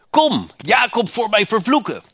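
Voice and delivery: king Balak's call. Retold or imitated. Balak summons Biljam to curse Israel, high and commanding. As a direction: summon the prophet like a demanding king. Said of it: "riep Moabs koning mij".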